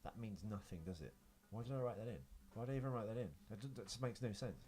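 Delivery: ordinary talk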